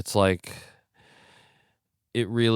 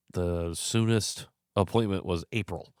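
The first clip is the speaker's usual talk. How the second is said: ordinary delivery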